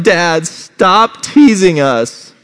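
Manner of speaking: put-on high, loud, drawn-out child's wail; hammed-up tearful whine; mock crying. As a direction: imitate an upset little girl pleading. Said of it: "starts crying. She's like".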